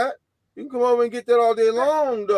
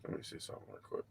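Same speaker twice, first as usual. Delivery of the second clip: whispered mutter; 15 dB quieter, much faster than usual. Real, not acted. Muttering to himself.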